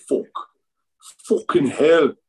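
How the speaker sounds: mock an English accent with relish; imitated English accent. Showing how English people swear, imitated English accent. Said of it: "there's the accent there, too, with them".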